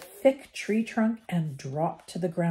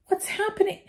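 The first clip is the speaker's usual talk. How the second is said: much higher, gasped alarm; 14 semitones higher, faster than usual. A narrator's reading. Ron panics as the tree attacks, gasping. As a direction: gasp it out in alarm. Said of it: "Ron gasped".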